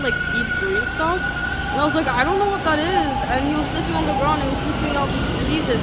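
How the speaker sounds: high, loud and worked up; really freaking out, voice climbing; agitated. Real, not acted. Ranting to the camera about disease, loud and high.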